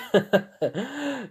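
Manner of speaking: high-pitched put-on laugh. Laughing at the other hero's remark, amused. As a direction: giggle high at the joke. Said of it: "I just laugh at that".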